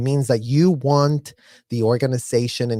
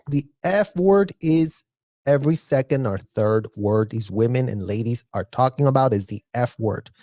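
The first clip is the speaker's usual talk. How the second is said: usual speaking voice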